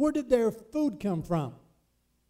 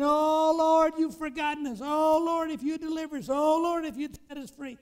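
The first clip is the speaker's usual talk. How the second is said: high, loud wailing Oh Lord; mock anguished pleading, much higher; pleading cry; 8 semitones higher, 6 dB louder than usual. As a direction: play the slaves crying out to God. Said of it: "they were saying".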